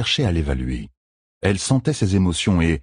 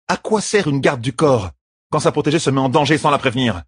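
higher, reproachful bodyguard; heated reproach, pitched up; angry champion; 8 semitones higher than usual. A narrator's reading. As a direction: scold the one you protect, wounded and indignant. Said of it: "Devant la rage de la championne".

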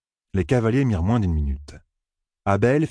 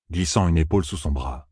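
ordinary delivery

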